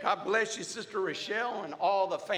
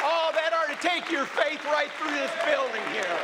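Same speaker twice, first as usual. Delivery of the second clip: raised, high-pitched praise over a cheering crowd; exultant preaching; 7 semitones higher than usual. Real, not acted. Preacher presenting a healed sister to the church, exultant preaching. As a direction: cry it out in triumph.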